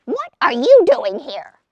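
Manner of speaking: loud, high, unfriendly shriek at him. Acted. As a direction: screech it at him like an angry magpie. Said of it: "she shrieked at him".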